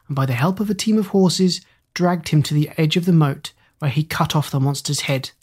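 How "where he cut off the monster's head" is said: plain reading voice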